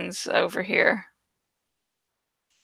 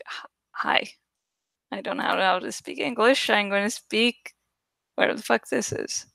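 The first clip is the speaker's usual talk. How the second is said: mock awkward self; 7 dB quieter than usual. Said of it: "it's like".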